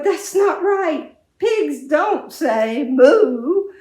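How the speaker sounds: slow, high, loud correction; indignant farmer. Acted. Farmer scolds the pig slowly and loudly. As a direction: spell it out to the pig.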